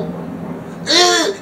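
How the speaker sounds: put-on high, gagging "ugh" like a grossed-out kid; kids' mock disgust. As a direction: squeal out a disgusted gag. Kids reacting to whiskey.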